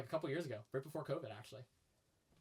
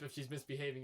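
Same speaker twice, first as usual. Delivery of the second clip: ordinary delivery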